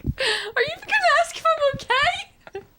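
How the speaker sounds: high, slow, laughing whine; whiny child. Retold or imitated. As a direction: whimper for attention like a kid. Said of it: "I'd be like".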